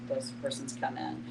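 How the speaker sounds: ordinary delivery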